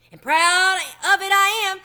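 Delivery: a mouse boasting proudly of his coins. A narrator's reading. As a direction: crow it out proudly.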